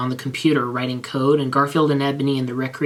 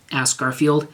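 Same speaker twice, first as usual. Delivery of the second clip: usual speaking voice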